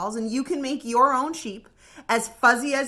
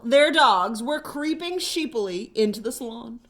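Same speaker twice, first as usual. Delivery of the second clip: usual speaking voice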